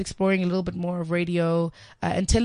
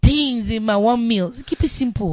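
Nigerian Pidgin; 2 semitones higher, 5 dB louder than usual. Pretend. Asked about breakfast, answering in Nigerian Pidgin. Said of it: "can you answer in, like, Pidgin".

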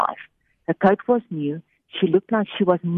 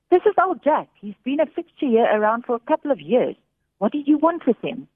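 officer; 7 semitones higher than usual. A narrator's reading. Officer voiced by narrator.

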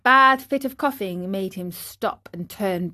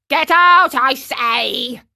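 loud, high shout that drops off; angry shout; 4 semitones higher, 7 dB louder, slower than usual. Acted. Fisherman chasing away the dog, angry shout. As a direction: bark the order at the dog. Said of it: "repeated the fisherman".

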